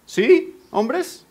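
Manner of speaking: ordinary talk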